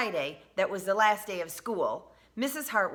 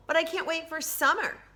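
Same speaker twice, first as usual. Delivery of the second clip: put-on high, bright kid voice full of excitement; excited child; 10 semitones higher, 4 dB louder than usual. A narrator's reading. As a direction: cheer it out like school's over.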